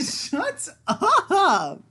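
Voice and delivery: high, loud laughing shut up. Real, not acted. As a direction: squeal it through laughter. Laughing at friend.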